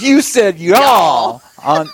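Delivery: loud, high, drawn-out Louisiana twang imitation. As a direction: crow it slowly in a mock Louisiana twang. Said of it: "she has this twang".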